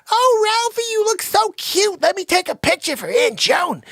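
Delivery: high, loud gushing coo; cooing mother. Retold or imitated. Mother cooing at Ralphie in the bunny suit. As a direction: gush over the cute kid.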